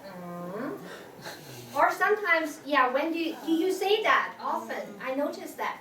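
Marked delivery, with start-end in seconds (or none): 0.1-0.8 "Mm" soft, starting low and rising; third tone. Shown as a demonstration. Answering the lunch question, low "Mm" coming up. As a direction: dip "Mm" low, then lift it. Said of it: "And you just say".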